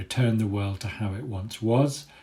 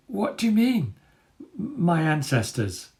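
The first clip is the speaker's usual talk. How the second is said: much higher, slow weary question; barely enough strength left to ask; exhausted boy; 7 semitones higher than usual. Acted. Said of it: "I have just enough energy to say".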